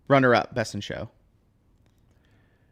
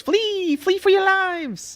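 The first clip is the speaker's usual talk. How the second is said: tiny squeaky mushroom voice; high, slow little mushroom cry; mushroom person; much slower than usual. Acted. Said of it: "Said in tiny mushroom voice".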